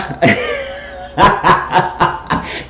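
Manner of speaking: breathy high laugh bursting out; real laugh. Real, not acted. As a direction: crack up at the story. Laughing at her own quote.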